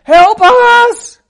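very high, very loud plea; screaming heads off. Acted. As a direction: scream for help with everything you have. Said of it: "They were just screaming their heads off".

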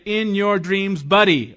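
slow, loud sarcastic brush-off; mock sarcastic retort. Preacher shows a wrong reply to a spouse's apology, sarcastic. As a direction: sneer it like a hammed-up refusal. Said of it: "You don't say".

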